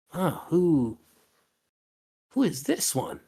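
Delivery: curious NPC. Acted. Armored host eyeing the newcomers, lightly voiced curiosity.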